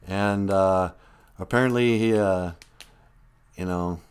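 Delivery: ordinary talk, nothing marked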